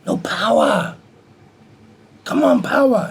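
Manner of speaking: high, loud demand, punching out each phrase; imitated scolding. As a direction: imitate an exasperated master goading his student. Master scolds his weak punching.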